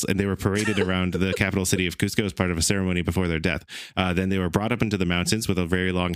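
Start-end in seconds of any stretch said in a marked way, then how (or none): 0.0-3.6 fast, rushed read; faster. Shown as a demonstration. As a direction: race through the sentence.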